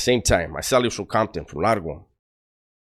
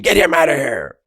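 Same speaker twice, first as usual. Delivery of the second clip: raised, higher-pitched guard's command; imitated shouted order; 6 semitones higher, 3 dB louder than usual. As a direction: call out like an officer clearing the room. Officers order cellmate removed.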